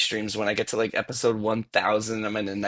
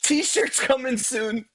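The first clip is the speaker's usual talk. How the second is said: streamer hype plug; 9 semitones higher, 9 dB louder than usual. Pretend. Mock streamer plug, laughing.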